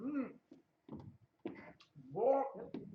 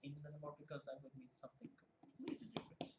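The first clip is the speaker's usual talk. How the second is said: whisper; 4 semitones lower, 16 dB quieter, faster than usual. Real, not acted. Whispered aside joke.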